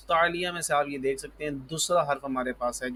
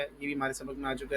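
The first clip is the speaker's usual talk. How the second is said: ordinary talk, nothing marked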